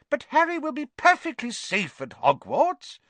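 cling to the hope he's safe; insisting mother. A narrator's reading.

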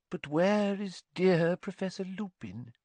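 slow, high-pitched mystical professor; misty seer. A narrator's reading. Seer asking the table after a missing colleague, misty.

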